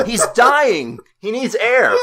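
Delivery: high, loud fake alarm; mock panic. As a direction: play up a panicked medic.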